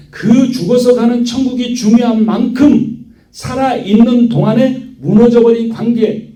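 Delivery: high-pitched, driving sermon delivery; impassioned preaching. Real, not acted. Pastor confessing himself a sinner before God, impassioned preaching. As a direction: proclaim it with sustained high intensity.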